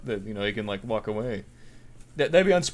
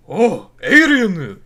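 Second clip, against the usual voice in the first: slow, much louder alarmed cry; mock shock; 4 semitones higher, 12 dB louder, much slower than usual. Officials gasping at the traveler in mock loud shock. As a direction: drag it out in hammed-up alarm.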